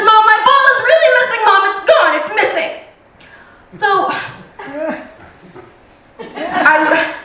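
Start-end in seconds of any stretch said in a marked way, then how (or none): 0.0-2.7 put-on panicked child. Naked son insists to mom, panicked little-boy voice. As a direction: play the frantic son, voice up. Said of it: "He said".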